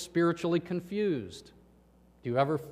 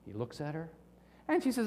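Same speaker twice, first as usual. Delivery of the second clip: normal talking voice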